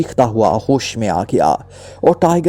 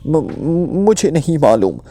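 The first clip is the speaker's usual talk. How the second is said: higher, scared stutter; stammering denial; 4 semitones higher, slower than usual. A narrator's reading. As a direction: stumble nervously into a denial. Prisoner stammers a denial under threat.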